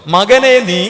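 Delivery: call and response. Shown as a demonstration. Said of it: "എന്ന് പറഞ്ഞേ".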